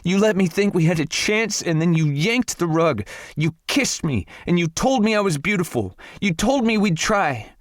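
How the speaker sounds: screaming. A narrator's reading. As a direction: scream it up high through hurt. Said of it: "Morgan's voice was turning hoarse from screaming".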